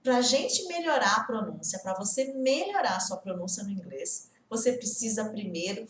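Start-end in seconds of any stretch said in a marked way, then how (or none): none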